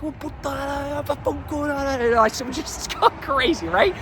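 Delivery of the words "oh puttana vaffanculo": high, hammed-up Italian swearing, half-joking; mock Italian cursing. Missionary recalling cursing God in Italian, mock spitting. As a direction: spit the Italian curses out in jest. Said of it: "I start spitting, like, Italian profanity".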